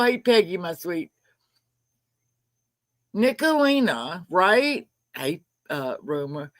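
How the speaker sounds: normal talking voice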